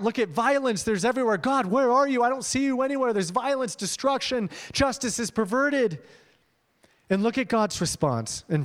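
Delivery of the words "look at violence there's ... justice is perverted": high, pleading outcry to God; crying out. Retold or imitated. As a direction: cry out as the distressed prophet. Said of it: "what Habakkuk is crying out".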